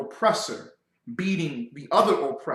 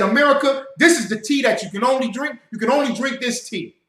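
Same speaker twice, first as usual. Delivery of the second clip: England's decree; 6 semitones higher, 4 dB louder than usual. Retold or imitated. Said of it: "and said".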